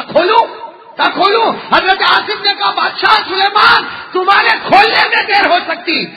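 full-voiced preaching. Preacher telling a congregation the Sulaiman story, full-voiced preaching. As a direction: tell it loudly to a congregation.

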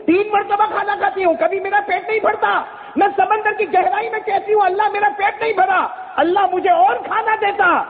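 lightly voiced fish arguing its case; fish's reply. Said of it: "फिर क्या कहा मालूम?".

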